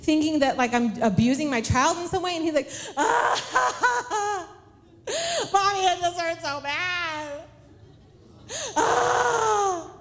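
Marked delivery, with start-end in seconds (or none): 3.0-9.9 put-on high, wailing little-kid cry; hammed-up sobbing that swells into a howl; mock bawling. Sick son wailing to Mommy in the store, mock bawling. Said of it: "Where they're, like, bawling".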